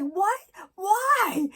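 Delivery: high, loud, laughing protest; protesting what why. Retold or imitated. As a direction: plead innocently through giggles. Sent upstairs, protesting while laughing.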